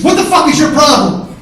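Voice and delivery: explosive high yell; furious shout. Retold or imitated. Furious brother storms at him in the store, enraged yelling. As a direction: scream it in his face, enraged. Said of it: "he's fucking furious".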